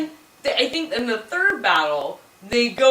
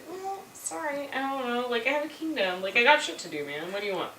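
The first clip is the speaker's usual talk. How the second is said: unbothered, half-hearted sorry; offhand non-apology; 8 dB quieter than usual. Retold or imitated. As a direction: brush off the accusation quietly.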